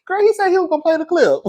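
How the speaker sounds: high-pitched mock outcry; mock viewer complaint. Imagined viewer complaining about the promised clip, high mock protest. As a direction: squeal it like a let-down viewer, playfully.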